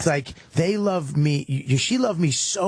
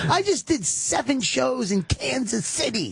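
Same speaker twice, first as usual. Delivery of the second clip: mock outburst; 10 semitones higher than usual. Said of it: "And I go".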